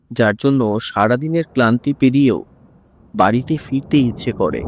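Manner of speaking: plain reading voice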